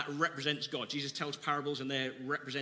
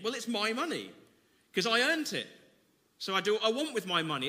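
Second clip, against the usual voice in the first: mock possessive; 7 semitones higher, 6 dB louder than usual. Mocking selfish money attitude.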